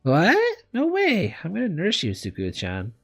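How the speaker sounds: high-pitched, insistent refusal; indignant surprise. A narrator's reading. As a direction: blurt it out high, then settle on the name. Girl reacts to being told to leave.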